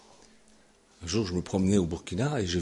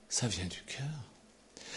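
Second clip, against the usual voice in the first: whispered slowly, much softer; 11 dB quieter, much slower than usual. Acted. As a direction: breathe it out gently. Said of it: "Et ça, ça vient du cœur". («Ça vient du cœur» chuchoté lentement)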